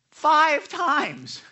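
FIVE TIMES loud and high, each word punched; exasperated stress on both words, "five" and "times"; emphatic exclamation. Real, not acted. Emphasising repeated asking in a story.

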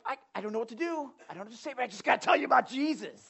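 fast, tumbling words, overwhelmed; flustered new believer. Retold or imitated. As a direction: rush it out, not knowing what to say. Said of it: "But you're just like".

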